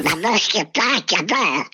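garbled quacking duck voice; Kalle Anka. Acted. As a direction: talk like a cartoon duck, out of one side of the mouth. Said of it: "när du är Kalle Anka".

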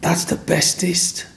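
high, slow, breathy whisper of wonder; awed little girl. Retold or imitated. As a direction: marvel at it like a small child. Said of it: "And she said".

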